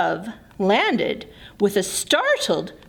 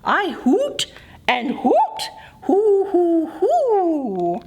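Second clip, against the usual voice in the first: half-whispered then higher hoo hoo; owl hooting; 5 semitones higher than usual. Acted. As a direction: hoot like an owl. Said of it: "Owl huffed".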